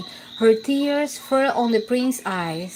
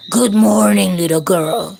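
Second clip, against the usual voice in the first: lower, gruff wolf voice; 4 semitones lower, 7 dB louder than usual. A narrator's reading. Wolf greets the little girl in a gruff voice. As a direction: growl it out like the big wolf. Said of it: "spoke to her in a gruff voice".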